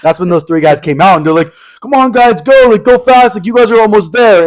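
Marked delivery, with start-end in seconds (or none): 1.8-4.5 higher, louder pep talk, lightly voiced; cheering strangers. Hikers cheering two scared friends up a cliff, higher and louder. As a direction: egg them on up the cliff.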